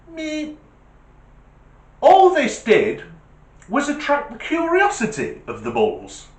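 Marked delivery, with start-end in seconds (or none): 0.1-0.6 tiny high soft meep; a pathetic little horn squeak; imitated feeble horn meep. Weak car horn trying to scare bulls, tiny high meep. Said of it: "it went".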